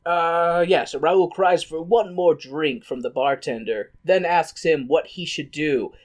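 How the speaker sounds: normal talking voice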